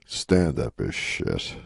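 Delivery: slow, low deadpan punchline; Rodney Dangerfield. Retold or imitated. Veteran comic undercutting his moving advice after a pause, slow deadpan. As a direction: let it drop low and drag it out. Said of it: "he pauses, and then he goes".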